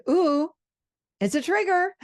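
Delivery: high, bright and loud delight at a trigger; delighted ooh. Shown as a demonstration. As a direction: squeal it like good news. Excited reframe of triggers.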